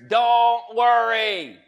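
loud, slow, ringing shout of the words; Jesus shouting. Retold or imitated. Preacher voicing Jesus shouting to the worried listener. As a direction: boom it out as Jesus commanding. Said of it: "hear Jesus shout in your ears".

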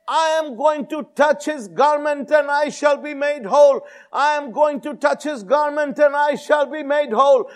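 insistent preacher's chant, pitched high; high, driving affirmation of faith; loud repeated declaration. Retold or imitated. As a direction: act out her declaration, proclaiming it over and over. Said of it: "she said loudly, repeatedly, she declared".

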